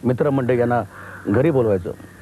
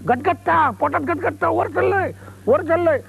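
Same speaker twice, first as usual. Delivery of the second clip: very high, panicky cry as the plane climbs; mother screaming in fear; slower than usual. Retold or imitated. Mother in the rising plane cries out to her son in panic. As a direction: shriek that her stomach is churning. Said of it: "आई ओरडते".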